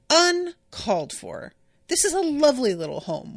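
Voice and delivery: uncalled for, sound truly affronted; indignant. Real, not acted. Host defending the lovely little home, indignant and high. Indignant reaction to a recap insult.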